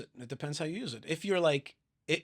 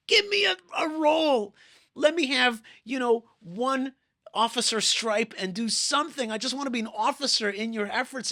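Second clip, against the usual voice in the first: loud, high begging; acted fervent plea, voice raised; impassioned pleading; 8 semitones higher, 7 dB louder than usual. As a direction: beg urgently for a role.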